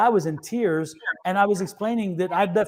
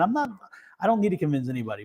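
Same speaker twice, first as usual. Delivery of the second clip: fast, softer inner retort; quick inner thought; 5 dB quieter, faster than usual. Retold or imitated. Psychic thinking back at the skeptic, fast and soft. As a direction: rush the thought under the breath. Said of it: "And I'm thinking".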